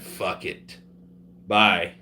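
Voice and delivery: normal talking voice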